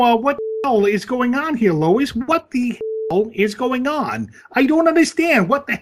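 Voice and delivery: high, loud cartoon father voice; cartoon dad. Quoting Family Guy father to Lois, high loud bluster.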